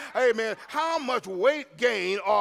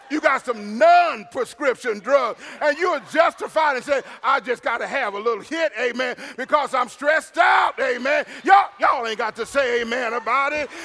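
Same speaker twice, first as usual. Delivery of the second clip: loud, high-pitched pulpit delivery; ringing sermon voice with amens; full-force preaching; 3 semitones higher, 5 dB louder than usual. Acted. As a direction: preach it hard, punching the key words.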